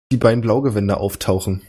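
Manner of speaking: ordinary talk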